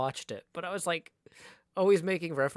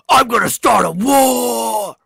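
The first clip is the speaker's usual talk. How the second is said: slow, much higher and far louder full shout; rock-star war cry, much higher and louder; shouted lyric quote; 7 semitones higher, 18 dB louder, slower than usual. Retold or imitated. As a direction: yell it like the rock singer. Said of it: "if I'm just like".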